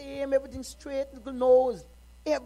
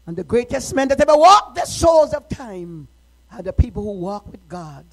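proclaiming; 8 dB louder than usual. Real, not acted. Preacher proclaims to congregation.

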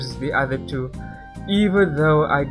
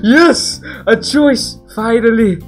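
excited; 8 semitones higher, 11 dB louder, slower than usual. Real, not acted. Finally gets a game choice.

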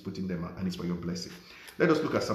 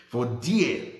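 "dear" held long and loud, pitch rising; stress on dear; 3 semitones higher, 6 dB louder than usual. Shown as a demonstration. Pastor marking the key word for listeners, stressed "dear". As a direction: lean hard on "dear". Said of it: "please underline that word".

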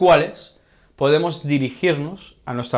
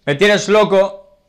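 higher, louder and slow, smitten; lovestruck declaration; 6 semitones higher, 7 dB louder, slower than usual. Shown as a demonstration. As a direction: gush it out, madly in love. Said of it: "aunque también podríamos decir". (declaración enamorada, lenta y fuerte)

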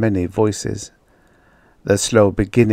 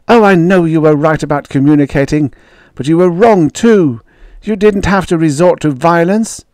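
high, urgent argument; raised-voice reproach; heated protest; 7 semitones higher, 4 dB louder than usual. A narrator's reading. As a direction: argue back at them, impassioned.

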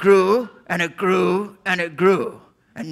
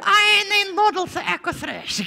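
much higher silly puppet voice; 14 semitones higher than usual. Acted. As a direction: squeak it like a goofy puppet. Puppet voice quote.

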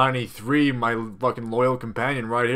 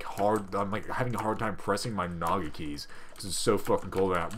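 ordinary talk

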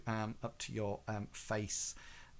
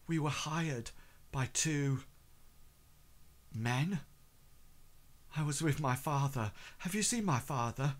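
a touch higher, halting, piecing memories together; halting recollection; 3 semitones higher than usual. Acted. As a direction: pause often as if recalling the order. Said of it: "trying to piece together what happened".

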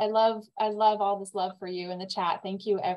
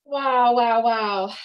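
awed wow; 3 semitones higher, 6 dB louder, slower than usual. Real, not acted. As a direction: let the wonder burst out. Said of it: "I can feel the applause".

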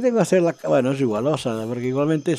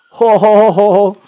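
loud, raised run of ho-ho-hos; real delighted whooping chuckle; gleeful "ho ho"; 5 semitones higher, 10 dB louder, slower than usual. Real, not acted. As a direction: crow over a lucky find. Delighted at free items found.

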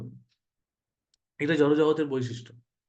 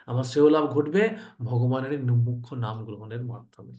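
ordinary delivery

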